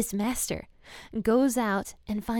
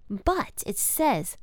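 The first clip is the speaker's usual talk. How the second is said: ordinary talk, nothing marked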